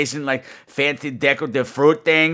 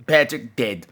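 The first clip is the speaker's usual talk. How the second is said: ordinary delivery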